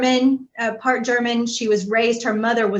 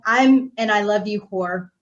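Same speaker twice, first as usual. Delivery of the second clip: ordinary talk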